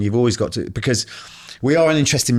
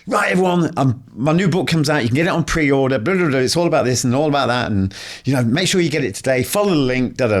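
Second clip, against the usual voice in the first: higher, bright hammed-up promo voice; mock influencer promo; 4 semitones higher than usual. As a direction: sell the book like a cheesy video post.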